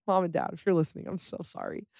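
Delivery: sheepish whispered apology. Real, not acted. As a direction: breathe it out, embarrassed. Apologizing to parents, embarrassed.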